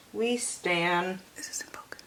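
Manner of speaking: soft unvoiced whisper; hushed muttered asides. Real, not acted. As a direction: whisper as if not to wake anyone. Whispering to camera.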